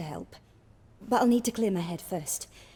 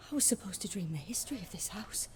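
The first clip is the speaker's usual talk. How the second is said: hushed, shaken whisper; 10 dB quieter than usual. Acted. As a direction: breathe it out unsettled. Shaken dreamer recalls nightmare.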